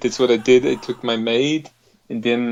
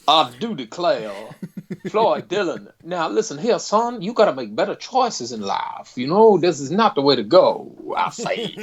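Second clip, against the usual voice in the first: blustery Southern gentleman, pitch pushed higher; Foghorn Leghorn voice; 3 semitones higher than usual. Pretend. Lecturing viewer Floyd on his choices, as a blustery Southern gent. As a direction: lecture him like a pompous old rooster. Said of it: "a certain foghorn leghorn thing going on".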